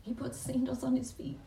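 choking up over the sandals; holding back tears. Real, not acted. Preacher moved near tears by the father's gift. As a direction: voice near tears, pushing through. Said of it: "Sorry, I don't wanna cry".

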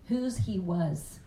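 usual speaking voice

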